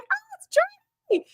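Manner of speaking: very high, slow, squealing delight; mock fan squeal on seeing Geordi; fangirl freakout. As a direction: squeal at the sight of a favourite. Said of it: "totally freaking out".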